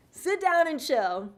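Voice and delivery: high, loud, drawn-out mock order; body talking back. Body telling her to rest, loud mock scolding. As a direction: stretch it out as an exasperated playful command. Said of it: "I think my body's like".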